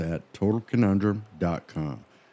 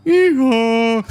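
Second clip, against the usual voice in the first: loud, high drawn-out yeehaw; mock donkey bray; 9 dB louder than usual. Co-host joking over the donkey tale, loud comic bray. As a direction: bray it out at full volume.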